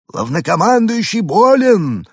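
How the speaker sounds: high, slow stern alien. A narrator's reading. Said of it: "строго ответил он". (строгий высокий голос пришельца)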